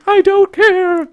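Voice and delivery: put-on very high, slow, loud girlish defiance; Ariel voice. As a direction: drag it out like a defiant teen.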